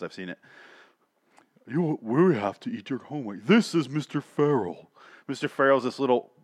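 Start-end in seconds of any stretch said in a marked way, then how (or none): 1.7-4.9 unhurried and proper, a hint of a stately butler; distinguished monster voice. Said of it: "like, very distinguished".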